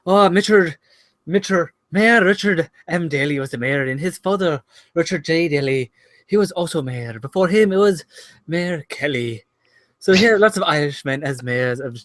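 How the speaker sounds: smooth, silky Irish accent. Shown as a demonstration. Accent guru showing off for the podcast, smooth Irish accent. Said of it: "when you did that voice, [name], that was smooth and silky".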